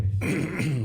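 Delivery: rough throat clear. Real, not acted. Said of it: "Sorry, I gotta get a drink".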